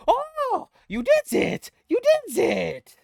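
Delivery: very high, loud goblin exclamation; Gob cheering. Acted. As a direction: cheer in a squeaky little goblin voice. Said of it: "and then he says".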